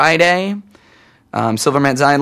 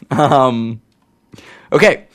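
ordinary talk, nothing marked

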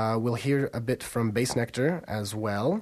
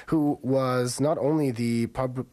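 normal talking voice

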